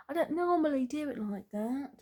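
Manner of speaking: put-on high-pitched playful British accent. Tarot reader explaining their method to viewers, British accent. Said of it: "I don't know why I wanna speak in".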